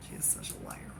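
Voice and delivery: hushed gossip; whisper. Real, not acted. Hushed complaint about Mary.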